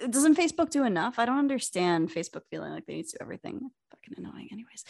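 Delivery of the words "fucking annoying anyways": breathy under-breath whisper; whispered aside. Real, not acted. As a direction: whisper it to yourself.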